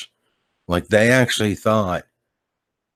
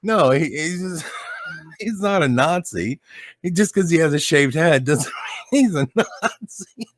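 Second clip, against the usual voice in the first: higher, giggling through the excuse; laughing while explaining; 8 semitones higher than usual. Retold or imitated. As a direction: retell it breaking into laughter. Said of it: "So I'm laughing my ass off".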